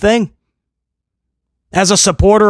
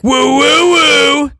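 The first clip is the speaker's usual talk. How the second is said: Zack Ryder woo; 6 semitones higher, much slower than usual. Retold or imitated. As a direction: belt out the woo woo woo like a wrestler hyping the crowd. Zack Ryder catchphrase again.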